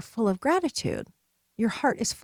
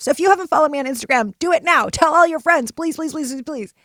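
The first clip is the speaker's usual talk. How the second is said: higher, louder, rushed mock plea; playful begging; 6 semitones higher, 7 dB louder than usual. Podcaster begging listeners to follow her on Instagram, playful begging. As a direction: beg the listeners in playful desperation.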